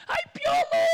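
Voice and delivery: very high-pitched fan shriek; star-struck squeal. Acted. Party guest spots Piolo Pascual, star-struck squeal.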